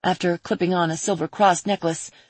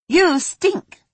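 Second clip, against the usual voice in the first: put-on teasing girl voice; 11 semitones higher, 5 dB louder than usual. Girl pinching nose, teasing.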